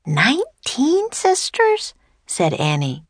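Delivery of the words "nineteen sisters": very high, slow incredulous question; drawn-out girlish astonishment; astonished. A narrator's reading. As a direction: repeat it in amazed disbelief. Annie reacts to surprising number.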